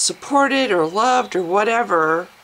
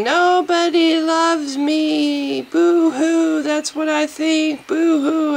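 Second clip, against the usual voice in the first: high, slow whiny mock boo-hoo; mock self-pity; 8 semitones higher, 4 dB louder, slower than usual. Vlogger mocking her old depressed self, whiny. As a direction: whine like a self-pitying child.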